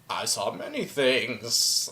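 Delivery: low, very quiet, cagey reply; evasive seer father. Acted. Father evading questions about his vision, cagey. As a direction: drop low and hushed, dodging the question.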